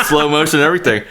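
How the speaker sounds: usual speaking voice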